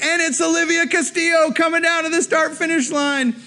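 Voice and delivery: high, excited finish-line announcer; race announcer. Retold or imitated. Man on the microphone announcing Olivia, excited announcer call. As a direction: boom it out like a sports MC. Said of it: "The guy on the microphone's like".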